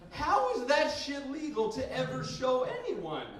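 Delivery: loud, high, slow indignation; outraged rant. Acted. Comic rant about pop-up ads.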